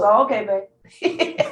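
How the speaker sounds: a lightly voiced dad taking the news in stride; laid-back dad. Dad in front of the TV shrugging off her news, lightly voiced and slow. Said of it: "He goes".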